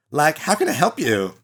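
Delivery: lightly voiced ditzy Valley Girl; Valley Girl accent. Student greeting the lawyer in a Valley Girl accent. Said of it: "[name] gets to do another Valley Girl accent".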